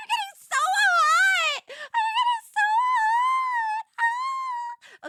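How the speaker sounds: very high, loud drawn-out wailing shriek; flustered wail. Pretend. Streamer overheating over her crushes, shrieking wail. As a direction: screech it out in an overheated meltdown. Mock meltdown over getting hot.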